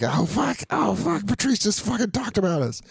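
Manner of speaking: excited panic. Retold or imitated. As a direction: squeal like a starstruck kid.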